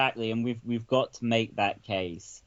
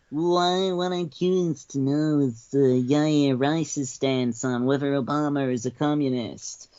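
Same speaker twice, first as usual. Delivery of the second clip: Dinesh D'Souza impression. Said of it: "Dinesh D'Souza".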